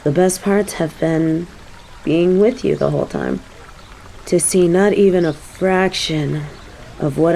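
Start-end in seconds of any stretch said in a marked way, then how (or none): none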